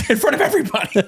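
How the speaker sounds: high, quick, laughing through the words. Real, not acted. Telling friend his embarrassing story, laughing in disbelief.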